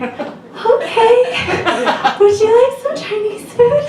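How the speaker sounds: squeaky polite offer to get rid of him; high nervous politeness. Retold or imitated. Twelve-year-old girl nervously offering a homeless man food, high and laughing.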